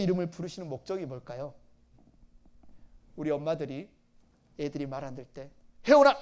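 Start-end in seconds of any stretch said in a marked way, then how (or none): 5.9-6.1 put-on a mother's sharp scolding call of the child's name; a mother snapping at her noisy child. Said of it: "혼내려고 하는 거죠".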